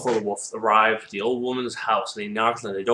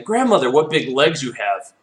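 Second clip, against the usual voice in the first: put-on louder, higher child of wonder; amazed little girl; 4 semitones higher, 7 dB louder than usual. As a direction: exclaim at grandmother, pitched up.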